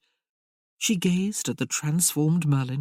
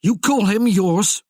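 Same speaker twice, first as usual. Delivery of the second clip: loud, slow, higher accusing question; 5 semitones higher, 7 dB louder than usual. Acted. Merlin accusing Tamara over her late husband, loud and slow. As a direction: hurl it at her slowly, hurt. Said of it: "Merlin accused".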